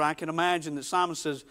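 ordinary talk, nothing marked

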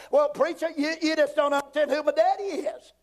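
high, pleading excuse; mock protesting objector. Churchgoer to preacher about family standing, high mock protest.

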